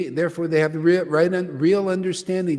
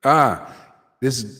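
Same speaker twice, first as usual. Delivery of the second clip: ordinary delivery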